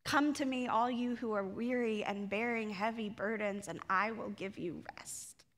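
soft, low, tender invitation; gentle invitation. Shown as a demonstration. Jesus inviting the weary, softly. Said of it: "the same Jesus that says".

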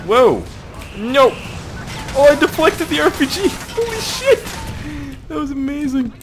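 very high excited squeal with laughing; thrilled amazement. Real, not acted. Gamer deflects the rocket, high laughing amazement. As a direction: marvel at it, voice shooting up.

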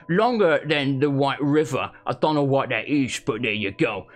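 broad Essex bloke reading aloud; lightly put-on Essex accent; Essex accent impression. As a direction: read it like a dim Essex lad. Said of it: "Gotta get back in character".

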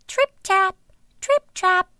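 high, light trip-trap; light tripping steps. A narrator's reading. Youngest goat crossing the bridge, high light patter. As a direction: step lightly in a high little voice. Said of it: "lightly tripping over the bridge".

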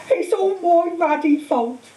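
slow, high, acted tearful wail; sobbing. A narrator's reading. Hagrid blaming himself to Harry, sobbing. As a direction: wail it through acted sobs. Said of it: "he sobbed, his face in his hands".

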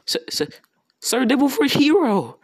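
high, nervous stammering call; a little scared. Retold or imitated. Guards waking the giant hero, a little scared. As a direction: imitate timid guards calling up to him. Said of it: "scared a little, so they're like".

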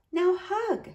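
put-on high, soft child's voice; small, sweet command; little niece, expectant. A narrator's reading. Niece in uncle's lap, soft high command.

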